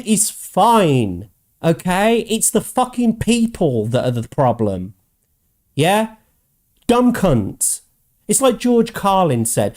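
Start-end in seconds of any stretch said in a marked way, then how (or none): none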